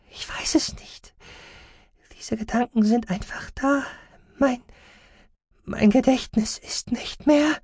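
halting, frail and high; frightened patient. Acted. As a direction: whisper rising to thin high voice. Frightened patient, memory failing.

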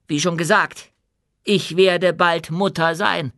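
proud, firm queen addressing her subjects; dwarf queen, commanding. Acted. Queen challenges the hall.

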